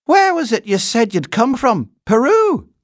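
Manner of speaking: loud, high-pitched questioning; brisk housekeeper. A narrator's reading. Housekeeper quizzing the bear on his home, brisk. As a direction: quiz him in a high, strident voice.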